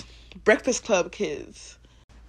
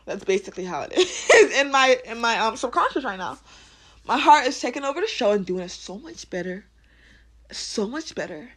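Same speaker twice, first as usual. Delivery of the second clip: normal talking voice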